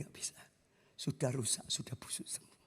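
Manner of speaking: near-whispered grim verdict; hushed doctor. Retold or imitated. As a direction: whisper it heavily, no hope left. Dokter menolak dengan berat. (dokter berbisik pelan, tanpa harapan)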